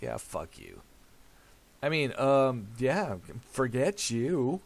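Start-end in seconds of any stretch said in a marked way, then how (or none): 0.0-0.8 much lower, quiet grumbled comeback; low muttered retort. Retold or imitated. Son's muttered answer to father's echo, low. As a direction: mutter it under your breath.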